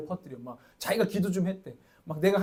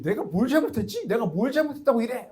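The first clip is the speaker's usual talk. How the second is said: louder, higher protest; indignant rebuttal; 5 semitones higher, 5 dB louder than usual. Retold or imitated. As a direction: argue back as if wrongly blamed. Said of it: "자꾸 반박이". (억울한 듯 따져 묻는 말투)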